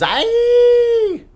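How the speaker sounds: high, loud drawn-out squeal of "Aye!"; Pogo. Acted. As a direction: squeal it out as one long, very high cry. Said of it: "Pogo probably just goes".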